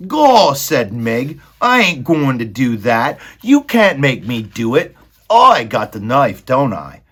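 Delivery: loud, high defiance; defiant maid. Acted. Meg defying Roscuro, loud and high. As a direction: stand up to the rat.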